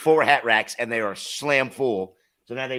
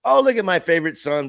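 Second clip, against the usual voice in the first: imitating [name]; 8 semitones higher, 6 dB louder than usual. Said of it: "he was like".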